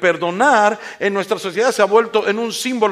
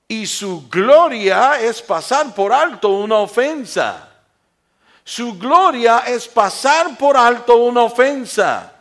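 loud, slow, raised preaching voice; forceful preaching; 5 semitones higher, 9 dB louder, slower than usual. Real, not acted. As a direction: proclaim it slowly to the whole congregation.

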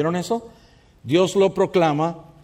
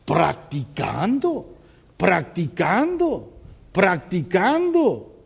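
drawn out very slowly, word by word; patient teacher pacing; slow repeated answer; 2 semitones higher, much slower than usual. Shown as a demonstration. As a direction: let each practicando sink in slowly. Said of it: "¿Cómo se perfecciona algo?".

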